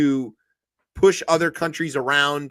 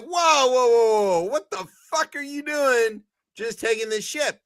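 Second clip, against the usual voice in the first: shocked protest; 8 semitones higher than usual. Retold or imitated. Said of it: "the rest of the world was like".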